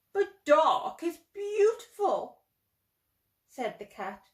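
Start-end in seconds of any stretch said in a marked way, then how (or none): 0.1-2.4 admiring. Acted. Cat praises the dark.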